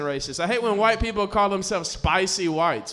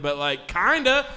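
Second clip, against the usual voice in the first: ordinary talk, nothing marked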